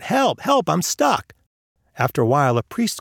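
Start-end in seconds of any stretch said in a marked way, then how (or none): 0.0-1.3 high-pitched mock cry for help; calling out. A narrator's reading. Man in a hole calling out for help. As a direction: call for help without much extra volume. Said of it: "calling out".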